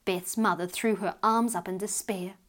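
ordinary delivery

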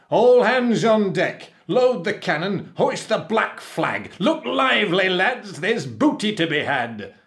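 high, full-volume pirate commands; shouted orders. A narrator's reading. Captain rallies the crew to attack, shouting. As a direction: bellow orders to the whole crew. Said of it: "he shouted".

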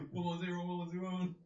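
binary read out steadily; robot saying binary. Retold or imitated. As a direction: recite ones and zeros like a robot. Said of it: "That's just what she starts saying".

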